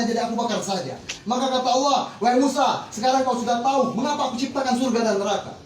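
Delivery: ordinary talk, nothing marked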